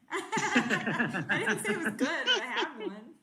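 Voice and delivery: laughing loudly through the words; sheepish loud laughing. Real, not acted. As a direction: laugh it out at your own bad joke. Laughing at own bad pun.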